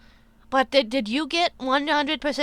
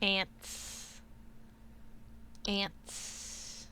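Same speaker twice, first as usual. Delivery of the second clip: breathy whispered word; 2 semitones lower, 14 dB quieter, much slower than usual. Pretend.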